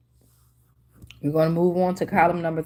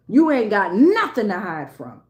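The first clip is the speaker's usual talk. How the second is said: ordinary talk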